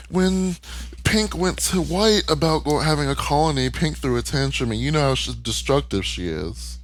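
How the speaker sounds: high, strained brush-off, insisting it's fine; voicing Pink Pearl. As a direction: play it like she's covering a hurt with forced brightness. Retelling Pink Pearl excusing abuse.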